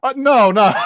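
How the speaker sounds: high, loud mock protest; flustered dad. Dad answering his tiny child's question, mock flustered. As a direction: imitate a dad thrown by the question.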